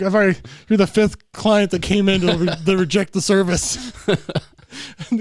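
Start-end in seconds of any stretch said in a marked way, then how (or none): 0.0-3.9 loud customer-service voice; loud hammed-up clerk; mock service clerk. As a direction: play a cheery attendant. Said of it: "I was like".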